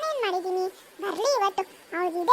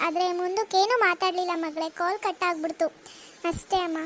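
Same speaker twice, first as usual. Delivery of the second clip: lower, gentle mother voice; 5 semitones lower than usual. Acted. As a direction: comfort the daughter, sad underneath. (ಮಗಳನ್ನು ಸಮಾಧಾನಿಸುವ ಅಮ್ಮ)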